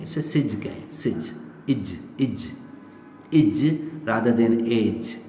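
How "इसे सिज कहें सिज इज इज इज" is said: सिज, इज with a short i before the j; ij ending. Shown as a demonstration. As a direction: stress the short इज ending, not age. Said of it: "इसको सेज ना कहें".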